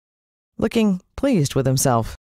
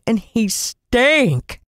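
disgusted; 6 semitones higher, much slower than usual. A narrator's reading. Disgust at stench.

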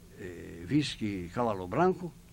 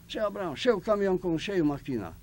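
excited shout; 7 semitones higher, 5 dB louder than usual. Retold or imitated. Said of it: "ele começou a gritar".

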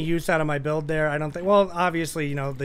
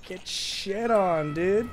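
higher, drawn-out playful jeer; restrained taunt; 5 semitones higher, much slower than usual. Real, not acted. Player taunts once more after just kidding, drawn-out playful jeer. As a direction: stretch the taunt out without yelling.

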